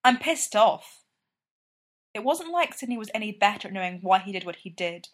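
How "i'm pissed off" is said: louder, higher, angry snap; loud angry retort; pissed off. A narrator's reading. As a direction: spit it out, fed up and furious. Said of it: "Sidney said doggedly".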